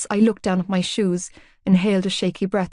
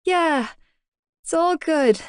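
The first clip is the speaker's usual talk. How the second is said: awkward; 9 semitones higher, slower than usual. A narrator's reading. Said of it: "cringing at my awkwardness".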